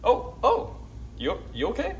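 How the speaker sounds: squeaky worried voice; high mock concern. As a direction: pitch up, playfully worried. High-pitched concern at something.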